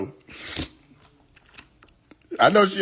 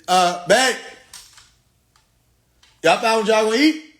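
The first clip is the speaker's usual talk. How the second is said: calling out; 7 semitones higher, 3 dB louder than usual. Real, not acted. Calling out to wife louder.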